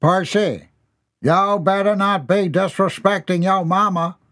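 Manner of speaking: a hint of a stern dad; scolding father. A narrator's reading. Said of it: "father scolds son".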